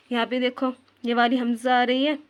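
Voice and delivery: ordinary talk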